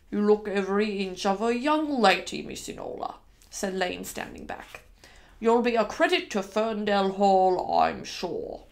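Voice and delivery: proud servant's send-off, sinking into creak; kindly old manservant. Acted. Manservant praising his young mistress as she leaves, in character voice. Lane's farewell to Enola.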